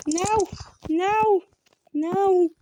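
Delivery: panicked high screams of no; shrieking no while fleeing; being chased. Real, not acted. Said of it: "porque eu tenho medo".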